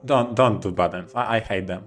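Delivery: ordinary delivery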